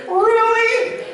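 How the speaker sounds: high, loud incredulous "Really?"; hammed-up Deadpool disbelief; mock surprise. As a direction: shoot it way up in disbelief. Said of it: "Deadpool went".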